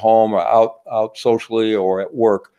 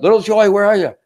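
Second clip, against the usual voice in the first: calling out much higher and louder; dad calling out; 10 semitones higher, 4 dB louder than usual. Acted. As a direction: yell across the backyard for the kid. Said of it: "goes out in the backyard".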